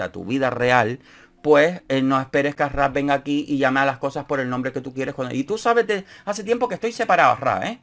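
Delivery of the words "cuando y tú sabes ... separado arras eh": high mock whine, a mimicked contestant; parroting him in a higher voice; mocking his complaint; mocking imitation. Mocking Ginés quoting him.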